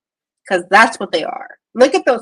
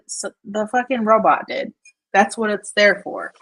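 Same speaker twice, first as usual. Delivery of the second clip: ordinary delivery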